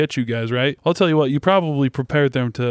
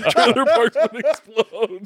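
laughing; 8 semitones higher, slower than usual. Real, not acted. Echoing joke while laughing.

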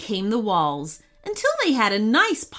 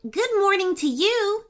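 high cheerful greeting; 11 semitones higher than usual. Acted. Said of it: "nodded Sadie and Denton".

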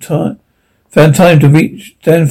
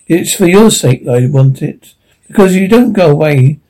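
ordinary delivery

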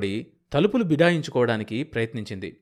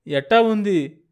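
higher and louder, urgent and afraid; worried asking; 8 semitones higher, 7 dB louder, slower than usual. Acted. Husband asking his fainted wife again, louder in worry. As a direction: press her for an answer in alarm. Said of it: "దిగులుగా అన్నాడు".

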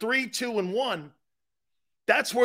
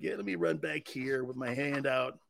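mock meek; 4 semitones lower, 9 dB quieter than usual. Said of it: "He's like".

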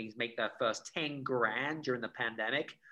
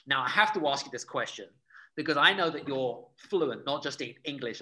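usual speaking voice

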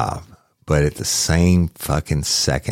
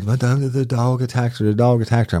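high-pitched mock distraught wail; imitated crying, upset; 8 semitones higher than usual. Old husband telling responder about the attack, imitated as distraught. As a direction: act out an upset old man, pitched well up. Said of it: "he's crying, he's all upset".

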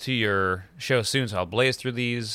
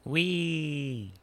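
soft gleeful little wee; a giddy whee as if spinning; 3 semitones higher, 6 dB quieter than usual. Acted. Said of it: "I'm spinning around out of glee".

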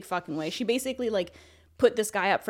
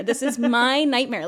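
slower and louder, stressing my; louder emphatic repeat; 4 semitones higher, 8 dB louder, slower than usual. Retold or imitated. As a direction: lean on my in This is my nightmare, loud and drawn out.

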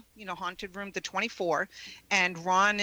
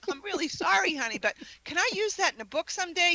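higher, fast sugary ask; mock sweet apology; 10 semitones higher, faster than usual. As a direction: coax him while you apologise. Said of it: "So I said to him".

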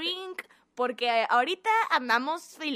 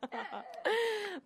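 breathy evil villain laugh; 6 semitones higher, 5 dB quieter than usual. Acted. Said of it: "La, la risa malvada".

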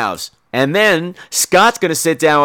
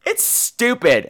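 normal talking voice

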